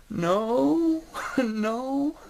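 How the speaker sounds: put-on high, whiny little-boy protest; scared little kid. Small boy pleading with the nurse, high and whiny. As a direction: squeal it out like a four-year-old who's terrified. Scared child refusing the needle.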